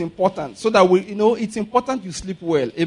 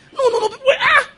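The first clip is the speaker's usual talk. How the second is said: laughing; faster than usual. Retold or imitated. Retold Okada crowd scene.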